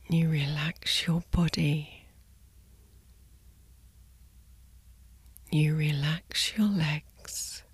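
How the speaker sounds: soft slow sleep whisper; soft spa whisper. Acted. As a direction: whisper them gently to sleep. Guided sleep meditation body scan.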